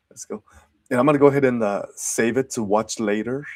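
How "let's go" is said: whispered cheer. Real, not acted.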